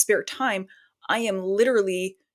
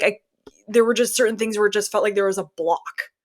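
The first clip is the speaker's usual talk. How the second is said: ordinary talk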